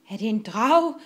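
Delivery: loud, mildly nervous asking; nervous question. A narrator's reading. Bølle Bent fears a dragon, nervous. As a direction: ask with a touch of nerves. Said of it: "spurgte Bølle Bent nervøst".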